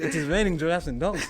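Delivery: put-on African accent, words carefully separated. Repeating his line to his brother as a joke, in a mock African accent. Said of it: "with the accent".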